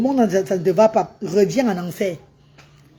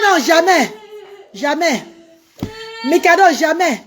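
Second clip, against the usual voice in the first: mock firm refusal; 6 semitones higher, slower than usual. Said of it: "J'ai dit".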